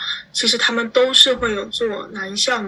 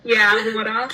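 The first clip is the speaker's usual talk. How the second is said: Australian accent. Shown as a demonstration. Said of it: "就比如说water".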